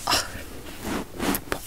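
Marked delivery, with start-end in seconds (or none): none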